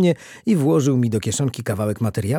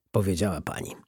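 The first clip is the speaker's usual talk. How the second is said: ordinary delivery, nothing marked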